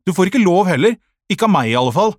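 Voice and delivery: high, loud scolding; indignant, forceful telling-off; really cross. A narrator's reading. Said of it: "Sara hørte skikkelig sur ut".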